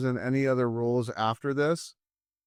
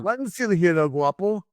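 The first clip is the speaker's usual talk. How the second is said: high, hammed Mexican accent; 6 semitones higher than usual. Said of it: "taught me my, uh, Mexican accent".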